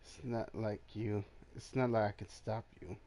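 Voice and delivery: normal talking voice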